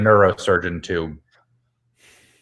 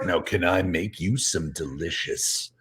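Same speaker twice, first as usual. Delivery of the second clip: soft, breathy seductive whisper; sultry whisper; 5 semitones lower, 8 dB quieter, slower than usual. Acted. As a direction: purr it low and seductive. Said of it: "delicious, sexy pancakes".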